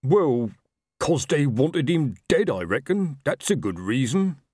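a hint of a dim, cheerful troll. A narrator's reading. Troll slow reasoning.

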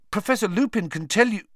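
high, fast blurt. Acted. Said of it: "unable to stop himself".

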